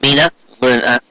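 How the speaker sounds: plain reading voice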